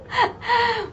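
high, soft real laugh; delighted laugh. Real, not acted. Teacher thrilled by the score list, soft high laugh. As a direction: let out a light high-pitched laugh.

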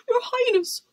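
high-pitched appeal; crying out. A narrator's reading. Head footman calling the princess under the bed, crying out. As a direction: call out high, pleading for attention. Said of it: "cried the head footman".

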